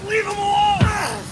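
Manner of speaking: high, loud, slow protective yell; desperate shout. Acted. Kid screaming at ghost to spare a friend, desperate shout. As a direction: scream to save him.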